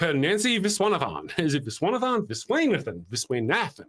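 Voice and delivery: Viswanathan said several shaky ways; mispronouncing Viswanathan; mangling the name. Retold or imitated. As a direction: stumble over Viswanathan, trying variants. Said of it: "I've been trashing his last name".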